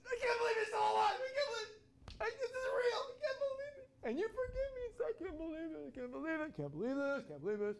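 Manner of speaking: very high, loud, tearful squealing; overwhelmed disbelief. Acted. Preacher acting out Peter after the resurrection, hysterically overjoyed. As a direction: cry it out in ecstatic disbelief, gradually coming down. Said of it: "Or was it more like".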